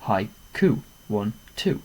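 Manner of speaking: "Haiku" split in two beats, then counted; "Haiku. One, two." counted deliberately; syllable count. Shown as a demonstration. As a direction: clap out "Haiku" for the class. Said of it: "that would have two syllables".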